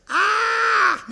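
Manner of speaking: huge, very high, loud yell; giant's shout. Retold or imitated. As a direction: bellow the giant's only line. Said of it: "All I've ever said was".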